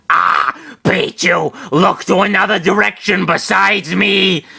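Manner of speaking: high, put-on creature yell; Pikachu. A narrator's reading. Small creature warning a friend about fireballs, high yell. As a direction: cry out in a strained high voice. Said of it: "Pikachu yelled".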